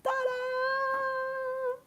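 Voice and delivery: high, long held note; ta-da fanfare. Real, not acted. Streamer revealing the snack to viewers, ta-da fanfare. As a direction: sing it out as a big reveal.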